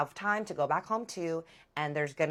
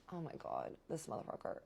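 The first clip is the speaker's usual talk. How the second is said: much softer, hushed and breathless; whispered curse; 13 dB quieter than usual. Retold or imitated. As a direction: hiss it under your breath. Muttering on seeing the ex.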